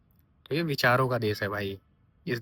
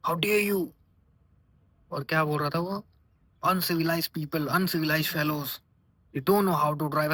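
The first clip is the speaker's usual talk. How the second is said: lightly voiced posh driver scolding in English; drawn-out uncivilized, half-hearted; mock English scolding; 3 semitones higher, much slower than usual. South Delhi driver scolds the biker in English, lightly mocked. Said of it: "वो English में उसे सुना रहा है".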